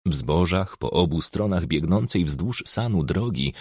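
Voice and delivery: ordinary delivery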